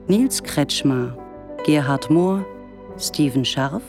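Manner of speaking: ordinary delivery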